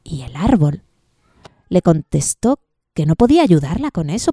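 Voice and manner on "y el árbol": plain reading voice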